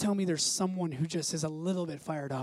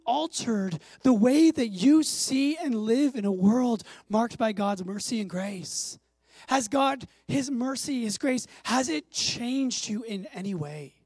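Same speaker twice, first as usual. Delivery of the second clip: fervent preaching; 3 semitones higher, 4 dB louder, slower than usual. Acted. Preaching at full force.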